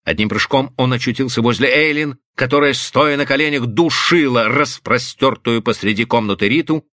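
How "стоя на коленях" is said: ordinary delivery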